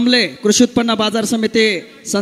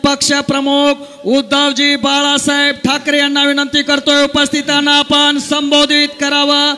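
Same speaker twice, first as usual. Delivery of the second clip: announcer; 4 semitones higher than usual. Acted. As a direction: introduce the chief guest grandly.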